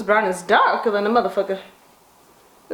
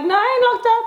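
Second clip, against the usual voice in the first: exasperated reply; 12 semitones higher, faster than usual. Retold or imitated. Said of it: "I was like".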